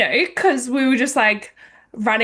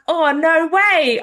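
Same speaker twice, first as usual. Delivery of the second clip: delighted surprise; 7 semitones higher, 3 dB louder than usual. Retold or imitated. Retold delighted reply about dogs.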